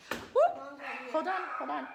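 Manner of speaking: sudden loud, high exclamation; real interrupted outburst; startled interjection. Real, not acted. Interrupted on camera, calls out.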